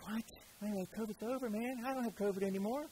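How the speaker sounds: a hint of a whiny protest; imitating a man tired of the label; mock protest. Pastor voices complaining covid guy.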